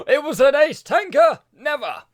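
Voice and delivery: gasp, then loud high feigned amazement; mock shock. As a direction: exclaim it with hammed-up disbelief, high and loud. Mock surprise at his own spoiler.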